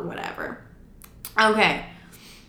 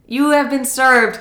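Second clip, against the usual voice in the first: slow, louder process server line; mock official announcement; 4 semitones higher, 5 dB louder, slower than usual. Server handing father divorce papers, mock-official announcing. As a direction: imitate a server declaring it deliberately.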